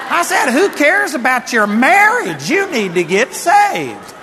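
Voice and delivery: loud, high rebuke to the congregation; forceful preaching. Acted. Preacher retelling his rebuke of a crying woman, loud and forceful. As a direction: preach it hard, slowing on the punchline.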